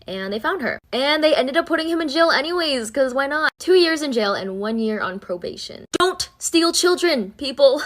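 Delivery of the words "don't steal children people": drawn out high, scolding the audience; mock stern warning. Real, not acted. Teller warns viewers after the kidnapping story, mock stern and slow. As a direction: wag a finger at everyone, slowly and high.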